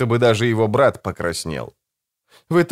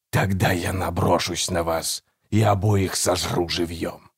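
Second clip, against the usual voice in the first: talking tree; 4 semitones lower, 4 dB quieter than usual. A narrator's reading. Hidden creature threatens the brothers.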